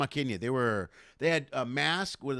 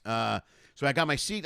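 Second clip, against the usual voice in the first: ordinary talk, nothing marked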